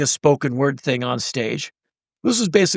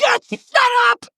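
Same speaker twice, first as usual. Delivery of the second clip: much higher, louder strangled plea; exasperated squeal; 4 dB louder than usual. Retold or imitated. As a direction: beg him to stop, squeaking. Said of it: "I'm like".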